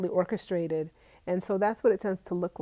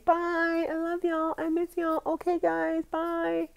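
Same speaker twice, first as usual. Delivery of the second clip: higher sing-song farewell voice; endless goodbyes; 10 semitones higher, slower than usual. Retold or imitated. To her viewers, mocking her own long farewells, sing-song goodbye. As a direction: play the host who can't stop saying bye. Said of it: "keep going and keep saying".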